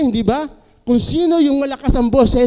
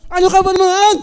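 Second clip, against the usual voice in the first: loud, high angry yell at someone; angry shout; 10 semitones higher, 7 dB louder, faster than usual. Retold or imitated. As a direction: snap it out like you're being yelled at. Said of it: "Sinisigawan ka na". (galit na sigaw)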